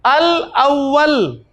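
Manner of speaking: drawn-out Arabic word, letter by letter; slow recitation. Shown as a demonstration. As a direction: spell the word out slowly for students. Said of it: "अलिफ". (धीमा, खींचकर उच्चारण)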